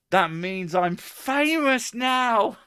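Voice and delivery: high, loud, drawn-out squeal of glee; mock starstruck. Man hearing of his name check, mock-thrilled. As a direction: gush slowly as if overcome by fame.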